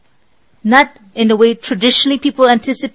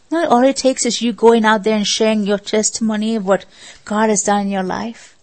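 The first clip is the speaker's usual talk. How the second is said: ordinary delivery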